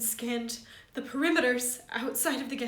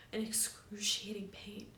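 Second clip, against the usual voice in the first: whispering; 6 dB quieter than usual. A narrator's reading. Father's sudden injury.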